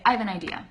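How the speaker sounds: "I have an idea" run together quickly as one word; "I have" contracted and linked straight into "an idea"; shortened, linked. Shown as a demonstration. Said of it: "I shortened it".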